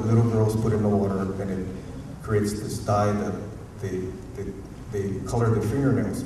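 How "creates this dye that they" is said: normal talking voice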